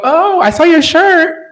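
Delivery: high, sing-song hinting voice; imitating parent. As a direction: say it pitched high, like a sly parental hint.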